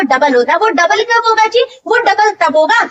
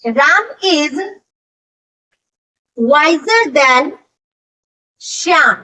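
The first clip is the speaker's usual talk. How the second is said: slow dictation pace; 3 semitones lower, much slower than usual. Shown as a demonstration. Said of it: "जैसे अगर लिखते हैं".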